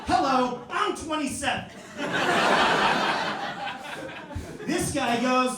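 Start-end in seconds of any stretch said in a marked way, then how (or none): 0.1-1.7 loud, high-pitched comeback; raised, affronted shout; indignant retort. Retold or imitated. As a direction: call out in offended protest. Acts indignant reply about his age.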